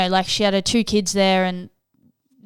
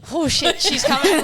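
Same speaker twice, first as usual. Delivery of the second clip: high, loud "oh shit" of sudden alarm; panicked realisation; 7 semitones higher, 6 dB louder than usual. Retold or imitated. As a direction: blurt it out in shock, pitched way up. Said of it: "I was like".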